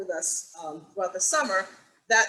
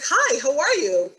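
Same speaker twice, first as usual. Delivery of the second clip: excited greeting; 6 semitones higher, 6 dB louder than usual. Retold or imitated. Greeting first student, retold excitedly.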